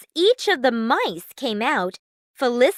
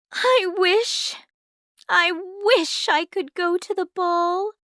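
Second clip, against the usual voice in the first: put-on high, longing girl's voice; wistful Cinderella; 8 semitones higher than usual. A narrator's reading. Cinderella alone at the tree, wishing in a high girlish voice.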